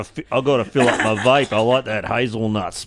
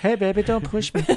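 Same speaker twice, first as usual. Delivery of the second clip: mock flirty; 9 semitones higher than usual.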